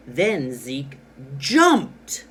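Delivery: JUMPED punched loud after a slow lead-in; slow down, then hit JUMPED hard; stress on JUMPED. Shown as a demonstration. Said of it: "I stressed this word, jumped".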